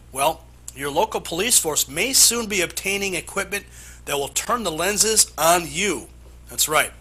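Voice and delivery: emphatic anchor intro, key words punched high; animated, punchy newscast delivery; news read. Acted. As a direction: sell the exclusive report like a TV anchor. Anchor introduces exclusive report.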